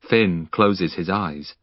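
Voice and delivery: ordinary delivery, nothing marked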